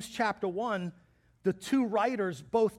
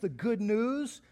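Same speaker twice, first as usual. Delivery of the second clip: normal talking voice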